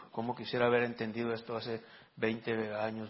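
normal talking voice

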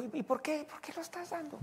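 high, soft puzzled question; lightly mocking suspicious man; suspicious stranger. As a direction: voice a nosy man asking why.

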